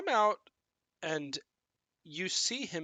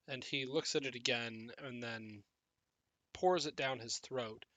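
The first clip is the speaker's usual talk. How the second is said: ordinary delivery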